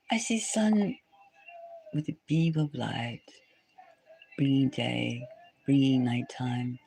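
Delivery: slow, soft, low seer voice; visionary trance. Acted. A seer describing visions of the future, softly and slowly. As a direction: murmur the visions as if seeing them.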